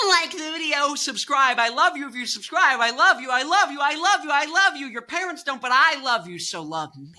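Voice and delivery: high, loud, gushing plea with a laugh; gushing outro. Real, not acted. Host gushing at viewers to subscribe, high and loud. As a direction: beg them to subscribe, overflowing and loud.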